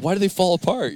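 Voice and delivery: high, puzzled self-quote; confused kid asking why. Retold or imitated. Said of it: "And I was like".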